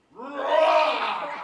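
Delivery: a full-throated mock lion roar, loud. Preacher roaring as the lion to the children. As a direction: roar out like a lion at the jungle's edge. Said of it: "And he started to roar".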